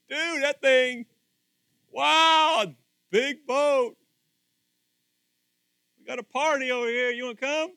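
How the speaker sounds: high, loud party invitation; partying neighbour. Retold or imitated. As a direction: call him over to the party.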